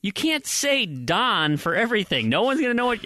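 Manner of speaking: high-pitched, worked-up mock arguing; exasperated protest. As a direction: plead your case, pitch way up. Acted exasperated argument over names.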